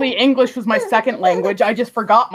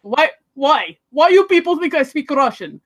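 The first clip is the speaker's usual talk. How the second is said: hammed-up Russian accent, higher pitched; 5 semitones higher, 3 dB louder than usual. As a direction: play an indignant speaker with a Russian accent. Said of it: "I can kind of do".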